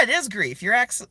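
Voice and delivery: ordinary talk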